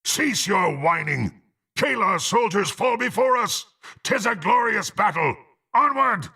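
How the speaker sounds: high, rousing shout to charge; rally the troops with a high-pitched yell; battle cry. Acted. Warrior urging allies onward against Kalar's soldiers, rousing battle cry.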